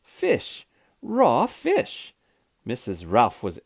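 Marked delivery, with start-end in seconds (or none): 0.0-2.1 high-pitched horrified exclamation; storybook shriek, not full volume; disgusted scream. A narrator's reading. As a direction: cry out in shocked disgust. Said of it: "she screamed".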